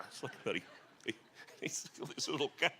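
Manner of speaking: ordinary talk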